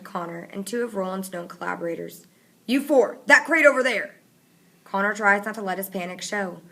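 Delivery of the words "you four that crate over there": loud commanding shout; shouted order. A narrator's reading. Fatigue ordering four boys, loud shout. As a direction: order them to the crate.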